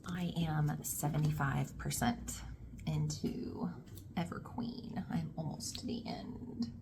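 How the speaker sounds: soft hushed whisper. Real, not acted. Reader whispering her progress update from a public place. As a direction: murmur it so no one nearby hears. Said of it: "I am in a public place".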